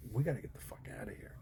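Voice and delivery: rushed, hushed panicky whisper; fast urgent whisper. Retold or imitated. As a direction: hiss it out quickly, eager to flee. Fleeing a riot, whispered reaction.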